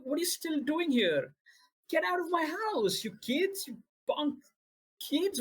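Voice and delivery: put-on high, loud, yelling at punk kids; panicked old man shouting for his wife; furious confused shout. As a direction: scream him out of the house. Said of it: "his demeanor shifts again".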